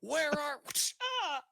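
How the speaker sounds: high shout breaking off in a squeal; shout, then squeal in pain. Acted. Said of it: "he shouts to the corner".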